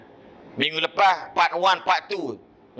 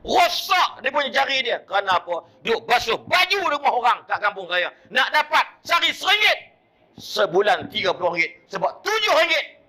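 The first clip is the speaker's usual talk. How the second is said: much higher, louder impassioned preaching; preaching at full force; 11 semitones higher, 4 dB louder than usual. Acted. Preacher telling the congregation of his grandmother's hardship, impassioned. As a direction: pour it out to the congregation with fervour.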